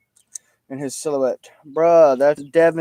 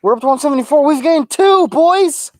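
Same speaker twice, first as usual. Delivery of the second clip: excited; 10 semitones higher, 4 dB louder than usual. Real, not acted. Excited about new subscribers.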